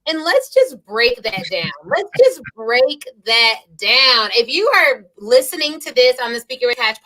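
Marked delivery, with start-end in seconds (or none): 1.8-4.3 stretched out, word by word; slow emphatic repeat. Real, not acted. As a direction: drag each word out for emphasis.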